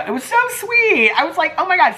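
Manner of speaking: sky-high gushing so sweet; gushing squeal. Real, not acted. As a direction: melt over how sweet it was. Touched by fan's friend.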